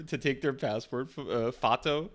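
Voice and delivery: lightly put-on Mongolian accent on 'passport, uh, fato'; Gonzorig voice. Streamer imitating his old Facebook character, lightly put-on Mongolian accent. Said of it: "That was the voice that I gave to him".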